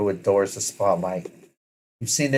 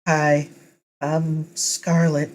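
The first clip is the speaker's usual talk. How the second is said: shy, slow nervous hello; 8 semitones higher, much slower than usual. Acted. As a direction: say it shyly, unsure. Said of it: "she says nervously".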